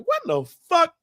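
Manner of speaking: high-pitched disbelief; incredulous retold outburst. Son answers father and brother, high incredulous outburst. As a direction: react high, as if ganged up on. Retells his own incredulous reply.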